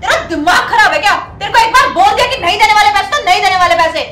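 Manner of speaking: loud, exasperated refusal; angry outburst. Acted. Customer refuses to pay delivery woman, loud angry outburst. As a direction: blast her with a loud refusal.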